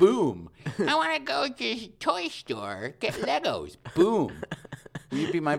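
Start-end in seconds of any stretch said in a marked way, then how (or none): 0.0-0.5 loud, punchy sound-effect word; sudden emphatic burst; instant-result boom. Pretend. Punchline after each childish demand. 0.8-3.8 put-on very high, slow childish whine; mock bratty kid voice; demanding little kid. Playing a child demanding a toy trip. 3.9-4.3 loud, punchy sound-effect word; sudden emphatic burst; instant-result boom. Pretend. Punchline after each childish demand.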